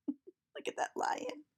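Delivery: hushed excited whisper. Acted. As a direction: whisper it like spotting a wild animal. Children point out a lion.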